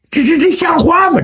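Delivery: sputtering, high, shouted protest; indignant outburst. Acted. Jiang Zhao fumes at his kicker, sputtering indignant shout. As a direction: stammer out your outrage.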